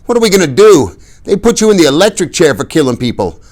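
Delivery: high, loud, frantic; panicked. A narrator's reading. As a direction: nearly scream in panic. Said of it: "I nearly screamed".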